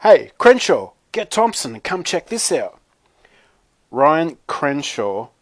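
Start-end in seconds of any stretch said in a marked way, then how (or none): 0.0-2.8 high, loud call-out; yelling across. A narrator's reading. Jason to his lunch table across the cafeteria, yelling. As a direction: holler to friends across a room. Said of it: "yelled to his lunch table".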